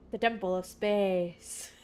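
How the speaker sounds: slow, grand pronouncement; put-on watcher voice. Streamer replaying the watcher's line, slow mock-solemn voice. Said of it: "why I'm talking like that".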